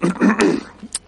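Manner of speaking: sudden real cough; choking cough. Real, not acted. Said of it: "me atraganta un poco".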